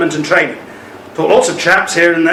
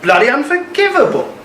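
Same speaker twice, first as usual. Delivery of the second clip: outraged; 7 semitones higher than usual. Acted. Outraged at the orphan trade.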